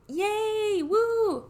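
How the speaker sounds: put-on high squealing kid's cheer, 'Yay, woo!'; childish cheer. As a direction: cheer up high like a thrilled little kid.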